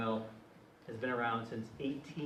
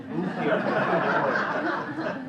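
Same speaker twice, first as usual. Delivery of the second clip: louder, slower, laughing through it; amused disbelief; 6 semitones higher, 10 dB louder, slower than usual. Real, not acted. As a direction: laugh at the irony of it. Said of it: "can you believe this?".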